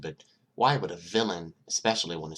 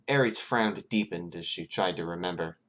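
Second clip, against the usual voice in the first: ordinary delivery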